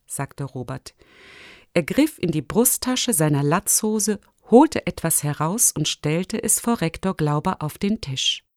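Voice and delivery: usual speaking voice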